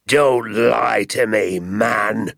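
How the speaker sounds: a furious landowner bellowing at the father; angry yell. A narrator's reading. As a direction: yell it at him, outraged. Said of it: "yelled Mister Hazel".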